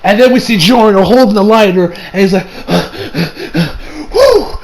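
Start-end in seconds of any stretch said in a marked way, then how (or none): 2.5-4.1 acted breathy panting; short puffs of mock relief; relieved gasps. Acted relief holding the lighter. 4.1-4.6 high, blown-out "whew"; acted sigh of a close call; relieved whew. Acted relief holding the lighter.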